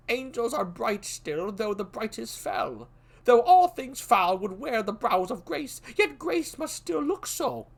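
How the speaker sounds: put-on young Malcolm. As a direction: weigh each word warily, pitched high.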